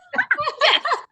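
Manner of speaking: bright high burst of laughter. Real, not acted. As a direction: laugh out loud at yourself. Laughing at her Southern accent.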